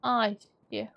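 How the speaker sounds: usual speaking voice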